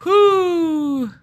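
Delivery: high loud whoop; excited whoop. Acted. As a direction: let out a celebratory whoo. Celebrating the reveal.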